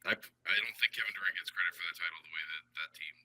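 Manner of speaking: thin tinny bad-mic sound; like talking through a tin can; tin can phone. Real, not acted. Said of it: "talking through a tin can".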